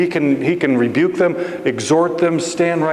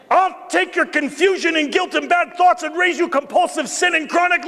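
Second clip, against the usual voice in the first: high-pitched, mocking one-up yell; 12 semitones higher, faster than usual. Heckler outdoing the confession from back of the room, yelling out. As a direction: call from way back, pitched far up. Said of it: "someone yells out".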